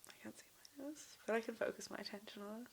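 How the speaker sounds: soft unvoiced whisper; hushed aside during the exercise. Real, not acted. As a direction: whisper it so as not to break the focus.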